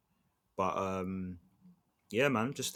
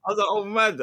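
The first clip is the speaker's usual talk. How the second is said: laughing through words; 12 semitones higher, 10 dB louder, much slower than usual. Real, not acted. Laughing while retelling.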